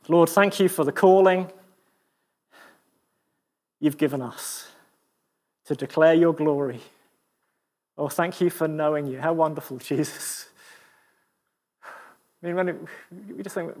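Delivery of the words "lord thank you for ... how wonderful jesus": closing prayer. Acted. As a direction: pray it gently to close. Leads a prayer.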